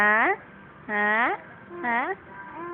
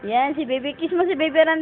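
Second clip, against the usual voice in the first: ordinary talk, nothing marked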